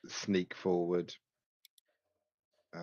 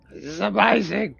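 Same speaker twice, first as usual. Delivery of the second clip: loud, high-pitched drugged elation; buzzing high; 13 semitones higher, 8 dB louder than usual. Acted. Adventurer high on the drugs, acted buzzing excitement. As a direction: whoop it out like you're buzzing.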